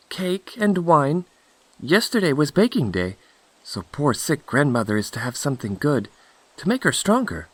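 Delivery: put-on high-pitched little girl voice; innocent Little Red Cap. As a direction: answer the wolf trustingly, pitched high. Red Cap answers the wolf.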